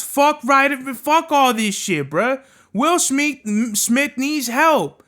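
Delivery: ordinary delivery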